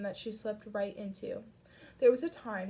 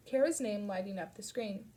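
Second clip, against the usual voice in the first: ordinary delivery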